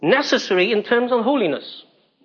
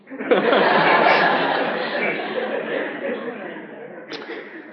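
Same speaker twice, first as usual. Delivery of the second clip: long breathy laugh. Real, not acted. Laughing at his own small salary.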